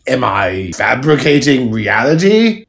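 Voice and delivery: ordinary talk, nothing marked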